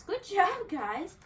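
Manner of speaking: big praise for the dogs; loud praise. Real, not acted. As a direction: cheer them on.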